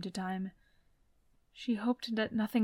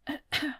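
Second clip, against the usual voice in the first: small, discreet throat-clear; polite cough; 6 semitones higher than usual. A narrator's reading. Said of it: "something like a polite cough".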